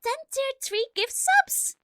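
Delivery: high, loud thrilled thanks; excited thanks. Real, not acted. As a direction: gush thanks, overwhelmed. Thanking for ten gift subs.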